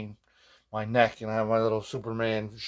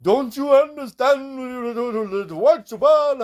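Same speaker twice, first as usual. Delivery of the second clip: very slow, higher, louder Trinidadian imitation; Trinidadian accent; 14 semitones higher, 7 dB louder, much slower than usual. A patient imitates his Trinidadian dentist scolding him, in her accent. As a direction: drag out the dentist's Trinidadian lilt. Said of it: "this woman from Trinidad".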